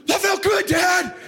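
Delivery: put-on high shouted boy's reply; elated kid imitation. Son answering dad after birdie, elated high shout. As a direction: mimic an overjoyed boy yelling.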